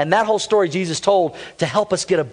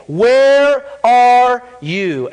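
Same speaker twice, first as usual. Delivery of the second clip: slow, drawn-out call; God calling out; 3 semitones higher, 4 dB louder, much slower than usual. Acted. Preacher voicing God calling Adam, slowly drawn out. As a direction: stretch the question out slowly.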